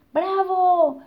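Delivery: high, loud cheer. Acted. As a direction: shout bravo with delight. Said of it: "dijeron todos los animales". (ovación aguda y fuerte)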